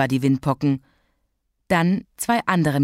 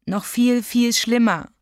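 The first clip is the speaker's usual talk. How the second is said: put-on high, plaintive child's wail; tearful; 6 semitones higher, slower than usual. A narrator's reading. Child missing her two friends, confiding in Mama tearfully. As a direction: lean on the misery, pitched up, lightly tearful. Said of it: "Mama wischte ihr die Tränen weg".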